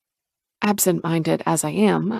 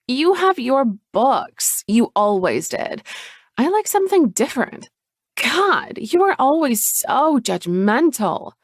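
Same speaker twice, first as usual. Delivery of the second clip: higher, bitter sister; pitched-up resentful sister voice; resentful Chloe; 6 semitones higher than usual. Acted. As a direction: lash out at her judgmental sister.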